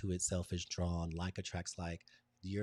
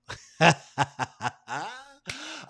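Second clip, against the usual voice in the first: laugh; 13 semitones higher, 9 dB louder than usual. Real, not acted. Bursting into laughter.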